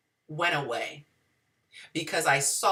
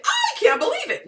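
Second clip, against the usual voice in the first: squeaky, much higher "I can't believe it" with the top on "I"; wide-eyed high-pitched disbelief; amazed disbelief; 5 dB louder than usual. Shown as a demonstration. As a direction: gasp it out like genuine shock, pitched way up. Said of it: "You say".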